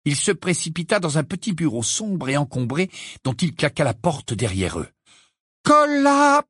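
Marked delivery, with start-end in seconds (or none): 5.6-6.4 high, loud incantation cut short; Hermione casting a spell. A narrator's reading. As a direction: cry out the start of a spell. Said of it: "commença Hermione". (incantation criée, très aiguë)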